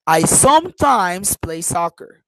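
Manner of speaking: "sometimes" punched, the rest lighter; stress on SOMETIMES. Shown as a demonstration. Teacher giving an example of sentence stress, SOMETIMES emphasized. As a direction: hit "sometimes" firmly, then ease off. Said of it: "I stress sometimes".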